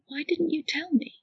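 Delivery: breathless unvoiced whisper. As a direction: ask it in a stunned whisper. Stunned question.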